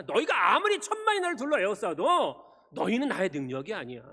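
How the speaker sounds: high, loud declaration building to a blessing; forceful preaching. Real, not acted. Pastor blessing the congregation from the psalm, forceful preaching. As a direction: proclaim it to the congregation.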